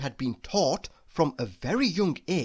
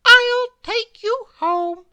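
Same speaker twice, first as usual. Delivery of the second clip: high, loud, slow kindly bird; comforting bird voice; 10 dB louder than usual. A narrator's reading. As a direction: reassure the worm as a chirpy bird. Bird's voice continues.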